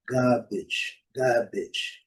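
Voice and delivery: "garbage" with silent R, long A; British pronunciation. Shown as a demonstration. Teacher repeating British English, "garbage" with long A and no R. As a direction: repeat "garbage" as "GAH-bidge". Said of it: "o inglês britânico é pronunciado".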